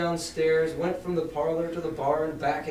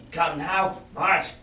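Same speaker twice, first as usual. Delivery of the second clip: cold command; much slower than usual. A narrator's reading. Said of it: "interrupted he".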